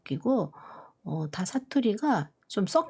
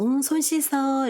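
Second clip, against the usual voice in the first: a mother's gentle instruction, higher; standard-speech mom; 8 semitones higher than usual. Retold or imitated. Telling her toddler to wash hands in standard Korean. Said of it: "이렇게 얘기를 하거든요".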